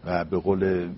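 ordinary delivery

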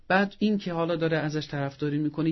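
ordinary talk

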